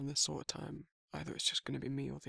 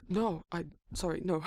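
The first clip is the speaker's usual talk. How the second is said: flustered laugh; 3 semitones higher, 10 dB louder than usual. Acted.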